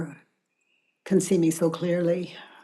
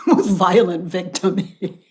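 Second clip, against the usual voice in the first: laughing through the words; amused laugh breaking in; 5 dB louder than usual. Real, not acted. Facilitator laughing at the victim contest, real laugh.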